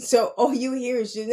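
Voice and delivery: ordinary talk, nothing marked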